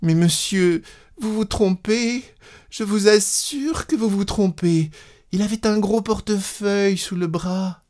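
high, pleading woman's voice; insist, pitched high, near tears; insistent, tearful wife. A narrator's reading. Wife insisting the conductor is mistaken, tearful. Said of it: "Elle insista".

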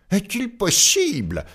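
high, outraged exclamation; indignant cry. Acted. Tailor retorting to the giant, high indignant cry. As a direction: squeak out your indignation. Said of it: "s'écria le petit tailleur".

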